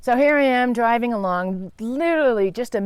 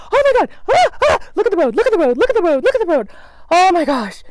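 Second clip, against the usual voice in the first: much higher, loud, frantic; panicked shrieking; 10 semitones higher, 5 dB louder, faster than usual. Retold or imitated. She panics at the wheel as the spider runs, shrill. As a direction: scream reminders at yourself.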